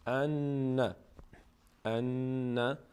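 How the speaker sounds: ان with the n held before release; doubled, humming n in ان; held doubled nun. Shown as a demonstration. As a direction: linger on the ن of ان. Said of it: "روی نونی که تشدید داره همیشه یک کمی".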